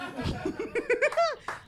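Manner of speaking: high-pitched real giggling; high giggle. Real, not acted. Comic delaying clean set, giggling. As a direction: crack up in a squeaky giggle.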